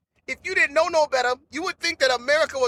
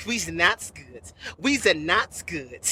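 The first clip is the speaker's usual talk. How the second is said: lower, slow, threatening we's not good; a lightly voiced street tough, slow and low; menacing thug voice; 9 semitones lower, slower than usual. Voicing the hostile guy, far timbre.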